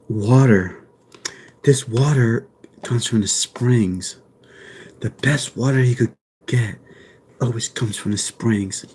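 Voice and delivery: mock ASMR. Showing off an ASMR act to Fifi, low and hushed. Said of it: "I'd be like".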